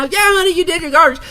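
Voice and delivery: very high, sweet Southern mom voice. Retold or imitated. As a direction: coo like a Southern mama. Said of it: "it was not a bad Southern accent".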